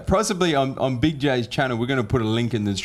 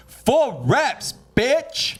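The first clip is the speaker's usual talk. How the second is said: high, pumped-up boast; triumphant brag; 13 semitones higher, much slower than usual. Real, not acted. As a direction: shout it out proudly. Bragging about heavy lift.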